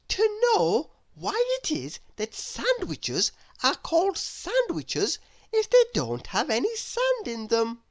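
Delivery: put-on a curious little girl asking sweetly; little Miss Curious voice. Narrator voices Miss Curious asking her door. Character voice.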